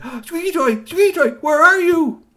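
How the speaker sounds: loud high calling. A narrator's reading. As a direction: call out into the device. Boy calling.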